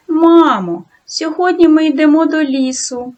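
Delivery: put-on bubbly boy telling mum the plan; cheerful. A narrator's reading. Yurko tells mother happily they go to the forest. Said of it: "весело сказав матері".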